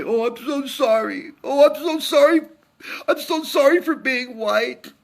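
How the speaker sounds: high, mocking sobbing whine; mock whiny apology. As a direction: sneer it out as a fake weepy apology. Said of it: "cry ourselves to sleep and say".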